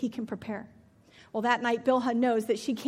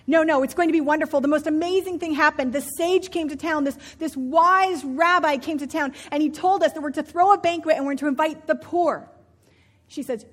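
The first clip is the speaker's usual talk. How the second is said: breathless, louder and higher with joy; excited husband; 4 semitones higher, 9 dB louder than usual. Acted. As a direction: gush like a man who can't contain good news. Husband gushing about banquet plan.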